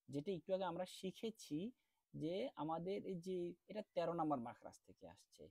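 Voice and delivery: ordinary talk, nothing marked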